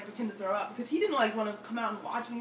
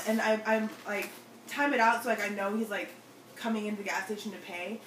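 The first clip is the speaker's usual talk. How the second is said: ordinary talk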